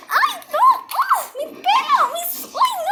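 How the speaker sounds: very high wailing cries; shrieking in pain. Acted. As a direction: shriek as your hair is pulled. Le tiran del pelo. (chillidos de dolor agudísimos)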